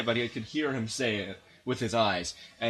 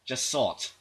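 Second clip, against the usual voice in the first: usual speaking voice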